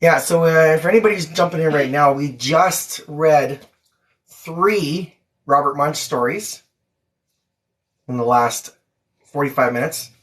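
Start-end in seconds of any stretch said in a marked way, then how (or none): none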